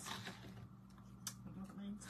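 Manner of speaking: whispered to yourself, confused; puzzled whisper. Real, not acted. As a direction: drop to a voiceless murmur. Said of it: "repeated, then whispered".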